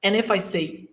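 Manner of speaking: usual speaking voice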